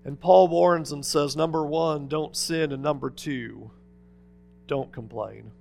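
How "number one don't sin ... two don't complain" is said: quiet earnest summary. Acted.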